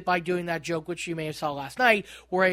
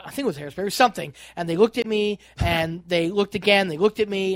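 ordinary talk

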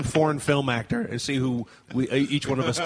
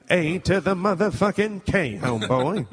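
mock rapper-broadcaster impression. Comic morphing rapper into sportscaster, impression. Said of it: "[name] is basically [name]".

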